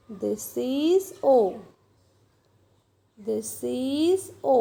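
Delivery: very slow teaching voice; long, lingering vowels; slow, drawn out. Shown as a demonstration. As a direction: stretch each word as if writing along. Teacher voicing letter while writing.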